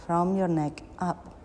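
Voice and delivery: ordinary talk, nothing marked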